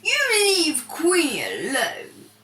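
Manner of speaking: slow, menacing shout; angry yell. Acted. Jealous bully warning the rival. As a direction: warn him off, slowly. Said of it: "he suddenly yelled".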